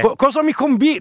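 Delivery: a clumsy try at a cheeky TV reporter; Staffelli. Pretend. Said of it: "non è che lo sappia fare benissimo".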